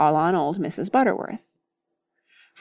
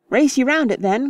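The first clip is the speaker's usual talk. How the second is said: higher, louder excited call; eager cry; 6 semitones higher, 3 dB louder than usual. Acted. Freddy challenges his friends to race, eager cry. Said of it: "cried Freddy".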